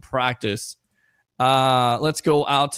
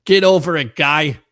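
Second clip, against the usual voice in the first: loud try at catchphrase; 7 dB louder than usual. Pretend. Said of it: "You gotta say for me".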